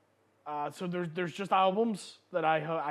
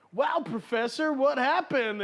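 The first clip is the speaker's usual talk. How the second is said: put-on movie kids; 7 semitones higher, 4 dB louder than usual. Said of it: "and the kids are like".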